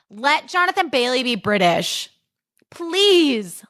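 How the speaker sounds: high, drawn-out plea; pleading. Real, not acted. Podcaster begging films to let the actor be British, pleading.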